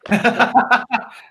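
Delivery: real laugh. Real, not acted. Co-host left to chat by himself, laughing.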